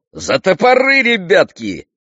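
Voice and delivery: very high, loud rallying call; excited cry. A narrator's reading. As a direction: call the men eagerly to work. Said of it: "вскричал он".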